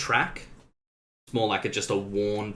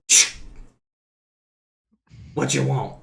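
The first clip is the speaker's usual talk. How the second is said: put-on gruff old man; 3 semitones higher than usual. Grumpy NPC at the slot.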